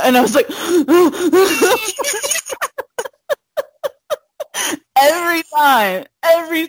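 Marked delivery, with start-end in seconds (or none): 0.5-3.1 loud, high-pitched fake sobs; mock sobbing. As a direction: burst into exaggerated high sobs. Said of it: "I cry".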